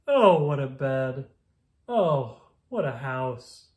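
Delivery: loud, slow, fed-up exclamation; exasperated groan. Acted. Ned groaning at animals crowding his bed, slow and loud.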